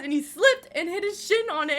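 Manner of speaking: normal talking voice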